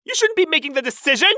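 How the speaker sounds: high, loud objection; indignant protest. Acted. Son protests arranged marriage.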